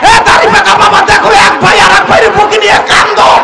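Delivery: high, loud, fast fiery sermon cry; fiery preaching. Acted. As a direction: rouse the crowd to run to their fathers. Preaching at full force.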